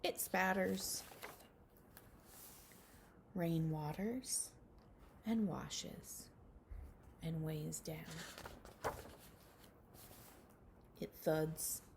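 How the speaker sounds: slow, hushed storybook reading with whispered lines; reading a picture book to children. Acted. Teacher reading a rain book to her class, hushed storybook voice. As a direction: read it gently to little ones, letting some lines fall to a whisper.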